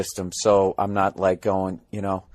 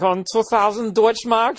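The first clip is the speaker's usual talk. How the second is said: put-on high, slow German-accented cashier; German accent, drawn out like a clerk quoting a price; mock German accent; 13 semitones higher than usual. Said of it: "then they're like".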